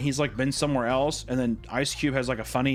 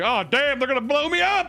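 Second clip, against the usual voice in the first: much higher, loud alarm; mock panic; 11 semitones higher, 4 dB louder than usual. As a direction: yelp it in cartoon fear. Panic voiced.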